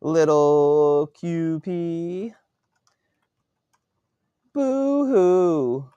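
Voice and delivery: high, slow sing-song jeer; mocking taunt. A narrator's reading. Bully mocking a crying victim, high taunting voice. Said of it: "jeers and mocking laughter".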